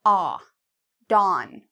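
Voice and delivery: dawn with an open ah. Shown as a demonstration. Teacher repeating dawn after the answer. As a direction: model dawn clearly. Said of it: "so it looks like an A".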